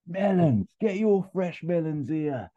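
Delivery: put-on high, loud, slow barrow-boy cry; market trader shout. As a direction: bellow it across the street like a greengrocer hawking fruit. Jason Statham as greengrocer calling out.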